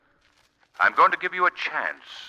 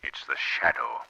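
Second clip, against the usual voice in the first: eerie unseen voice; 11 semitones lower, 5 dB quieter than usual. Acted. The Shadow speaks from nowhere.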